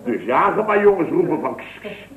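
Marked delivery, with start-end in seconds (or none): 1.5-2.1 shooing hiss. Retold or imitated. Shooing the bird away.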